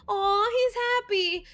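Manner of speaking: high, slow, drawn-out aw; adoring coo. Real, not acted. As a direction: coo over a happy dog. Cooing at wagging tail.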